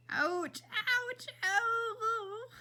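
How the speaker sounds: high yelps of pain, rising; pained cries. Acted. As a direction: cry out as the alcohol stings. Mother's wounds dabbed with alcohol.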